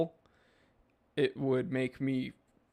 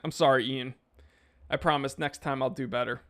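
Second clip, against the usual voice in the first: ordinary delivery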